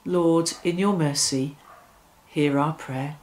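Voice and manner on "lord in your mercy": normal talking voice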